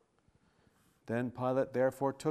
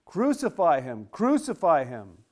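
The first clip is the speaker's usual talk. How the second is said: a mob demanding death; crucify him shouted twice, loud and falling; crowd crying out; 2 semitones higher, 7 dB louder than usual. Acted. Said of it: "they cried out".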